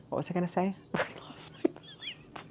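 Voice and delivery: soft embarrassed whisper with a laugh. Real, not acted. Losing her point mid-video, a giggly whisper. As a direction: let it slip out under your breath. Loses her train of thought.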